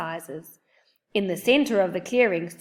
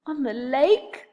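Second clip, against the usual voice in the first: gulped; 6 semitones higher than usual. A narrator's reading. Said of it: "Oliver gulped".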